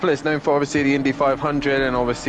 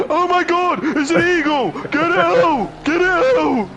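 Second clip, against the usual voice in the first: mock panic; slower than usual. Joking to co-host about the eagle, high hammed-up panic. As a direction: squeal it like an eagle got in.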